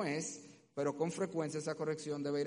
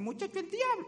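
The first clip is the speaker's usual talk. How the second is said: mock angry yelling. Shown as a demonstration. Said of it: "No es gritería".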